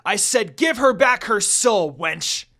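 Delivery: high, loud angry demand; furious shout. Acted. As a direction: yell it as a final warning. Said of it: "Inuyasha growled again".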